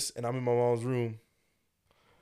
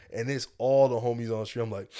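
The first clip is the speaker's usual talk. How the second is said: ordinary delivery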